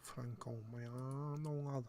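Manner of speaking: slow, stretched Mancunian delivery; a lightly voiced Manc accent. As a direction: roll the name out like a Manchester lad. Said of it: "good name to say in a Manc accent".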